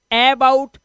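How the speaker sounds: "about" misread with an open "ae" first vowel, ऐबाउट; "about" wrongly begun with a stressed ऐ sound. Shown as a demonstration. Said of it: "इसको जब आप पढ़ेंगे तो इसे".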